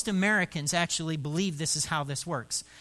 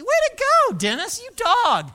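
mock cheer; 11 dB louder than usual. Pastor mock-cheering Dennis, much higher and louder. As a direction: cheer him on sarcastically.

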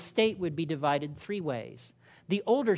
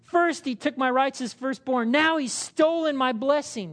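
higher, louder bitter complaint; aggrieved; 6 semitones higher, 3 dB louder than usual. Acted. Esau complaining to his father, bitter and higher. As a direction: wail about the stolen blessing. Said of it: "Esau exclaimed".

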